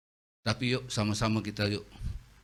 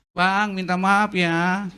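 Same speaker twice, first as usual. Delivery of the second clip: higher, loud and very slow; slow apology; 7 semitones higher, 10 dB louder, much slower than usual. Retold or imitated. Preacher apologizing to the driver, slow and placating. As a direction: draw out a conciliatory sorry. Apologizing after staring match.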